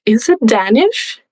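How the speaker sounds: slow, puzzled question, drawn out; mock baffled. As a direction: act out hearing a strange dialect, bewildered. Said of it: "and I was like".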